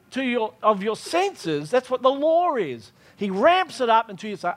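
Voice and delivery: ordinary talk, nothing marked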